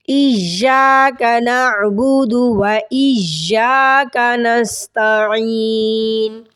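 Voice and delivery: slow chanted tajweed recitation; Quranic recitation. Shown as a demonstration. Teacher reciting the verse for students. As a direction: draw each word out, chanting.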